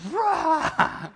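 put-on sudden high roar at little kids; playful monster roar; mock roar. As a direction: roar to scare the tiny ones. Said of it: "I'm like".

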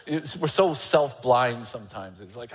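ordinary talk

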